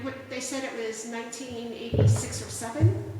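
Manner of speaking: ordinary delivery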